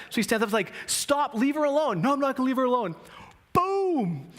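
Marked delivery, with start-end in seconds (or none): 0.9-2.0 high, slow drawn-out order; firm demand. Retold or imitated. Buddy standing up to the bully, insistent. 2.0-2.9 high-pitched mock defiance; defiant refusal. Bully answering the buddy back, defiant. 3.5-4.3 high, drawn-out "boom"; punch sound effect. Retold or imitated. Storyteller landing the buddy's punch, explosive.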